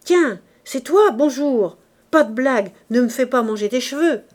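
loud, high-pitched greeting turning to protest; agitated, delirious exclaiming. A narrator's reading. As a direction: hail someone, then warn them off. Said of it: "Il haussait toujours la voix".

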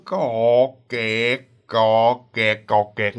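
low, droning creak of a swinging hammock; low repeated creaks; imitated creaking hammock. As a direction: mimic rhythmic creaking down low. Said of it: "cái giọng bắt đầu nó đưa".

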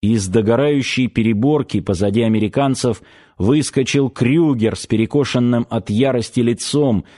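usual speaking voice

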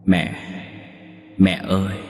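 echoing ghostly call; mournful ghost daughter calling out; eerie distant voice. A narrator's reading. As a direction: call for mother from a faraway otherworld. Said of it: "giọng nói âm u như từ cõi xa xôi vọng về".

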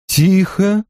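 sharp high order to hush; commanding. A narrator's reading. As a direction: command silence firmly. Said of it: "приказала Морейн".

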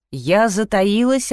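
ordinary delivery

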